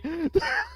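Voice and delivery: squeaky laugh of disbelief; high disbelieving laugh. Real, not acted. Laughing at discovery.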